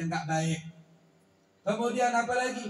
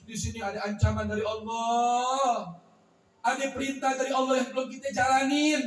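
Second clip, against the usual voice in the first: devout wife; 3 semitones higher, 3 dB louder than usual. Acted. Pious wife explains her tears.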